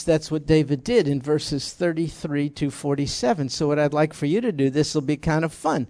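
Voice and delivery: ordinary talk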